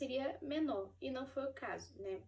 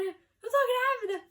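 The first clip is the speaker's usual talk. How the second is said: much higher and louder, slow and glowing; overjoyed; 11 semitones higher, 13 dB louder, slower than usual. Retold or imitated. Telling husband Igor the news, overjoyed. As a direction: burst with joy at the news. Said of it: "Fiquei feliz".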